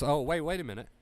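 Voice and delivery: normal talking voice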